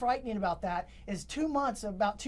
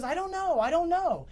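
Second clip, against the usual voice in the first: much higher, frantic imitation; retold hysteria; 7 semitones higher, 4 dB louder than usual. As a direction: imitate a distraught woman insisting she knows nothing. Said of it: "just hysterical the whole entire time".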